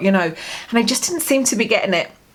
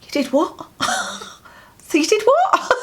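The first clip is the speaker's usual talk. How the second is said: high, incredulous "what?"; can't believe what she's hearing; high pitched shocked disbelief with a laugh; 13 semitones higher than usual. Retold or imitated. Shocked daughter quoting herself.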